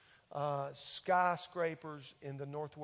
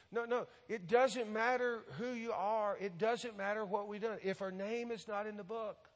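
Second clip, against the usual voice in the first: higher-pitched, insistent rebuttal; emphatic preaching; 5 semitones higher than usual. Real, not acted. Preacher refuting churchgoers who assume they'll get in, emphatic. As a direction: drive the warning home, pitch held high.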